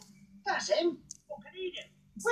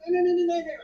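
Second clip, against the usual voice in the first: hasty correction; 4 semitones higher, 10 dB louder, much faster than usual. Acted. Hastily correcting a misunderstanding.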